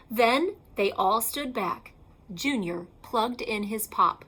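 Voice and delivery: ordinary delivery, nothing marked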